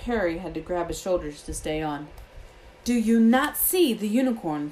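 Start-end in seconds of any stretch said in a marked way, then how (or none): 2.8-4.7 raised, higher voice in anger; bellowing. A narrator's reading. Firenze rearing in anger at Bane, bellowing. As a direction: bellow an angry challenge. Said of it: "Firenze bellowed at Bane".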